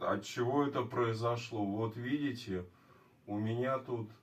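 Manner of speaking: ordinary talk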